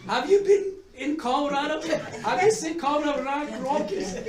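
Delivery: loud, high, mocking retort with a laugh at the end; angry note. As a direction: sneer at Vermont's little hills. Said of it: "wrote a nasty note".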